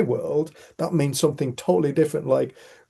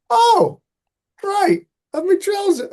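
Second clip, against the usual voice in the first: mock dismay; 6 dB louder, slower than usual. Retold embarrassing moment up tree.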